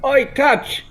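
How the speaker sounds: high call to someone below; shouting down. Acted. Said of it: "Ember shouts down".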